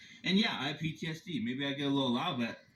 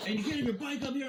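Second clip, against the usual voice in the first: mocking the guard; 9 semitones higher than usual. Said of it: "so he's like".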